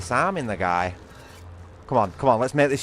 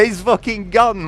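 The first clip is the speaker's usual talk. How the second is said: higher, slow, savouring it; elated; 10 semitones higher, 3 dB louder, slower than usual. Real, not acted. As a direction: drag the words out in glee. Celebrating the kill.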